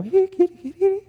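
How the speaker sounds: high sing-song cat call. Retold or imitated. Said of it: "like how we call cats".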